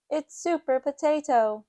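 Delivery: ordinary delivery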